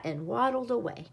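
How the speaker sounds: usual speaking voice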